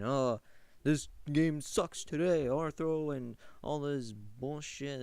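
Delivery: loud, high, whiny nostalgic griping; mock complaining. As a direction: imitate whiners pining for the old days. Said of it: "complained about how".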